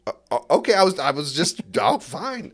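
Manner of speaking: high, stammering mock protest; flustered comeback. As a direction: act out being caught off guard, then giving in huffily. Caught off guard by being fired.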